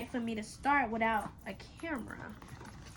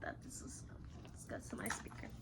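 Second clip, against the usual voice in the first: quiet whisper to herself; 12 semitones lower, 16 dB quieter, faster than usual. Real, not acted. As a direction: breathe the words. Repeats line in whisper.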